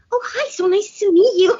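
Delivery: high, gushing hello through a laugh; overly sweet mock hello; mock bubbly greeting. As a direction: play the clueless gushing greeter. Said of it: "I'm gonna be like".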